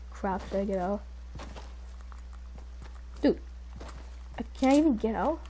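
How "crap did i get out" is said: ordinary talk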